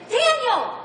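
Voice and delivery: loud, high cry of a desperate king; frantic shout. Retold or imitated. A frantic king shouting for Daniel at the den. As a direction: yell his name into the den. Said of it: "He's calling out to a dead man".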